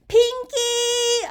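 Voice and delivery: high, loud calling shout. A narrator's reading. Child searching the house for Pinky, loud high shout. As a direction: call out for the lost mouse. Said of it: "I shouted".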